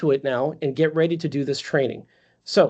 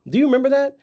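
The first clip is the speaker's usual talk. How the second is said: excited; 8 semitones higher, 6 dB louder, faster than usual. Pretend. Role-playing warm-up with client.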